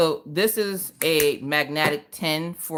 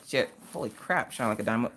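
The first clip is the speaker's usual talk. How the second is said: ordinary talk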